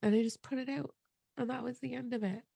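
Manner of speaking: high, soft, choked-up voice; holding back tears as she finishes; tearful. Real, not acted. Telling her host the story, tearful and choked up. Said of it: "I gotta get boxes of tissue".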